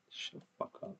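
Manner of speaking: quiet, barely voiced irritation; whispered aside. Real, not acted. Muttering at the creator's video in a whisper. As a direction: hiss it under your breath at the screen.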